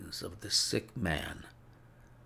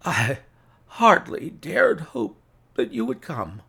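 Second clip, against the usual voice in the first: lightly voiced invalid, halting and louder; sick man's voice; 2 semitones higher, 8 dB louder than usual. A narrator's reading. Sick Holmes to his visitor, halting invalid voice. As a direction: strain the words out in broken pieces. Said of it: "Holmes whispered".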